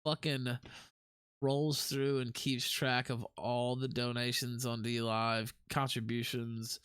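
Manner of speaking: usual speaking voice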